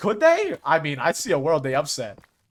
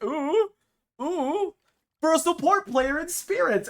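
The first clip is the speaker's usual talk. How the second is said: excited ooh; 10 semitones higher, slower than usual. Retold or imitated. Excited reaction to roster news.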